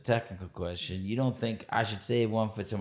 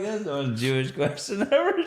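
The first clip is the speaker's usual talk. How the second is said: laughing; 8 semitones higher, 5 dB louder than usual. Real, not acted. Amused punchline with laugh.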